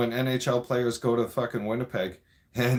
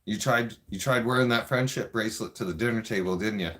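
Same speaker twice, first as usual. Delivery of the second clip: ordinary delivery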